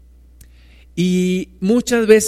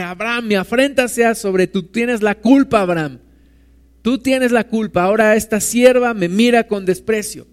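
higher, accusing wife; indignant blame; 5 semitones higher than usual. Acted. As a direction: throw the blame at her husband, indignant. Sarai blaming Abraham.

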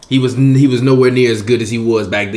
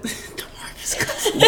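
mocking whisper; 11 dB quieter, much slower than usual. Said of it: "Why are you whispering that?".

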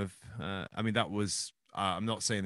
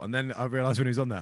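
ordinary delivery

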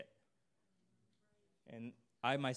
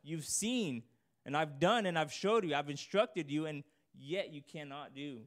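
a little aggressive; 5 semitones higher, 5 dB louder than usual. Retold or imitated. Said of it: "a little aggressive towards them".

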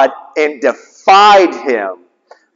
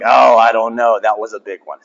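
mock hesitant God; 10 semitones lower than usual. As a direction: playfully voice a God who dithers over a big sin. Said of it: "God's not looking down and being like".

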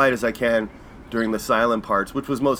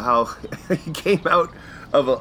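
ordinary delivery

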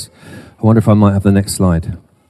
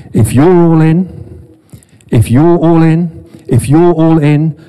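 voicing God; 6 semitones higher than usual. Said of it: "God is saying".

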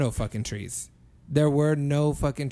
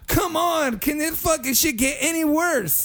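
high-pitched retold despair; exasperated; 10 semitones higher than usual. Man lost among hallway doors, high exasperation. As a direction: plead upward at your rotten luck.

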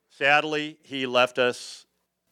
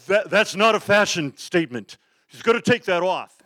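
loud, high indignant outburst; acting out an outraged promoter, pitched way up; 7 semitones higher, 6 dB louder than usual. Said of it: "[name] grabbed [name] and goes".

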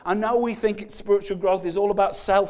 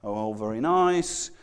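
mock polite shrug; 6 semitones lower, 5 dB quieter than usual. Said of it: "it feels kind of quite innocuous".

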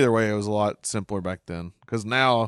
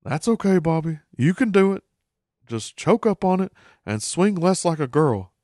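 higher, mock encouraging Hank; a dad coaching his son, lightly hammed; imitating Hank; 7 semitones higher, 3 dB louder than usual. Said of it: "Hank is visibly disturbed".

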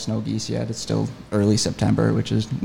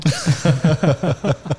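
laughing; 6 semitones higher, 5 dB louder than usual. Real, not acted. Laughs after retold call.

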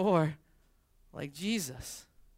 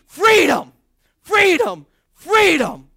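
huge shouted war cry, much higher and louder; Braveheart battle cry; 11 semitones higher, 16 dB louder, much slower than usual. Pretend. Pastor leading congregation in a Braveheart freedom cry, full-throated shout. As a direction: scream it like a warrior charging into battle. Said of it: "in your best Braveheart voice".

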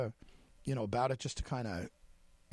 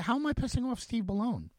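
pitched way up in incredulous protest; baffled disbelief; 10 semitones higher than usual. Retold or imitated. Reporter to a friend, higher, baffled disbelief. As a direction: ask it like the idea is absurd. Retelling his own baffled reply.

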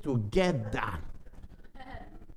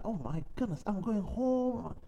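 dazed murmur; 4 semitones higher, 9 dB quieter than usual. Retold or imitated. Looking around at his emptied home, a soft stunned murmur. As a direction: let it sink in quietly, almost to yourself. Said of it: "I was just looking at".

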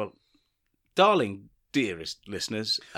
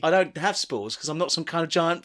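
indignant retort; 5 semitones higher than usual. Retold or imitated. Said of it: "turned around and said".

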